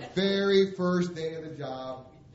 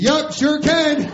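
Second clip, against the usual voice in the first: loud, high, drawn-out bluff; false confidence; 12 semitones higher, 15 dB louder, much slower than usual. Retold or imitated. Applicant lies to boss about driving, blustering confidence. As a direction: overdo the confident yes, very loud. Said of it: "I lie".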